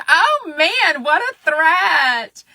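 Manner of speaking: high, drawn-out sarcastic awe; hammed-up, high-pitched mockery; mock alarm. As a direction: tease your own threat in a slow singsong. Mocks own snitches-get-stitches threat.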